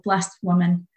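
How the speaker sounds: ordinary talk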